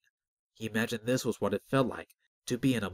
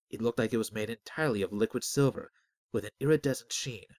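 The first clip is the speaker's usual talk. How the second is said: plain reading voice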